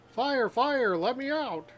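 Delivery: high, loud acted alarm; trapped character yelling to escape; panicked cry. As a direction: shout for help from a fire.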